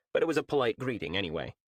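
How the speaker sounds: plain reading voice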